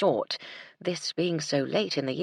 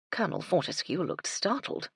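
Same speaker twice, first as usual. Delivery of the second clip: ordinary delivery, nothing marked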